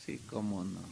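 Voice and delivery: low, quiet sarcastic "Sí, cómo no"; sarcastic aside. Real, not acted. Preacher mocking Judas' excuse, dry sarcasm. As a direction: dismiss it dryly. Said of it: "sarcastic aside".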